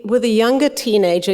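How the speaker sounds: normal talking voice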